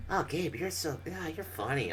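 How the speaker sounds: high mock-sweet voice; retelling mom teasing about the shirt; mom impression. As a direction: tease like a doting mom. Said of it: "and it's like".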